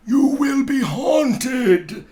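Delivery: loud, high, slow spectral wail; booming ghost. Acted. Marley's ghost warning Scrooge, loud and wailing. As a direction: proclaim it like a doomed ghost.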